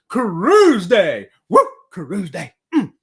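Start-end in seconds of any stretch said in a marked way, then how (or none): 0.1-1.7 slow, loud announcement; excited. Real, not acted. Host announcing tomorrow's cruise, slow and loud. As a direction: savor each word of cruise day. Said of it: "I'm just so excited about it".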